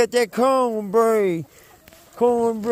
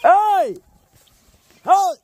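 shout; 9 semitones higher, 4 dB quieter, much slower than usual. Real, not acted. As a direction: yell to stop them. Yelling at the animals.

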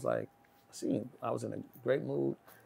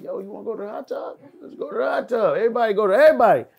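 hyped invitation; 10 semitones higher, 11 dB louder, faster than usual. Retold or imitated. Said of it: "I'm like".

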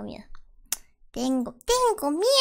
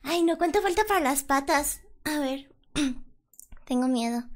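normal talking voice

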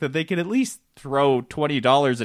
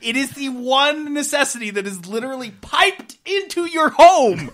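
exasperated rant; 7 semitones higher, 4 dB louder than usual. Real, not acted. Angry rant about water.

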